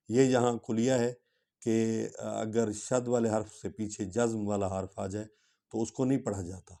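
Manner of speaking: usual speaking voice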